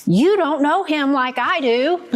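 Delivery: put-on sulky teen brushing mom off; typical teenage answer. Daughter to mother about her boyfriend, mock teen defiance. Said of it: "the great typical teenage answer".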